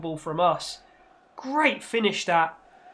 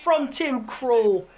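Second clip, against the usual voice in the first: excited commentary; 6 semitones higher, much slower than usual. Acted. Commentator calls a big save.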